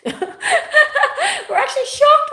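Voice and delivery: high, slow laughing exclaim; shocked laugh breaking through. Real, not acted. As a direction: laugh it out in disbelief.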